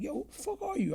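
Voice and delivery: imitating a man stunned at who he's facing; incredulous challenge. Guy in court asking who he is, pitched high and incredulous.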